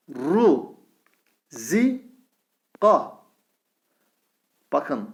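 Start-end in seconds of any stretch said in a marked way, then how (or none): 0.1-3.2 each syllable said alone and drawn out; syllable reading drill. Shown as a demonstration. Teacher reads syllables one by one to the class, slowly. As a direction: spell it out syllable by syllable. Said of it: "Başlayalım".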